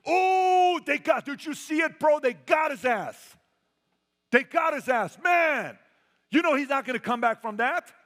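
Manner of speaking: loud jeering taunt, high and shouting; rowdy mock celebration over a downed player; mocking trash talk. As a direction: gloat like a rival who just saw the hit.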